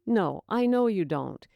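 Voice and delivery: ordinary delivery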